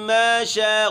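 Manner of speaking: مَّا with a nasal ghunna, شَاءَ stretched; nasalized مَّا, long شَاءَ. Shown as a demonstration. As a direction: hum the mim of مَّا through the nose. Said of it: "غنہ کرنا ہے ماں میں".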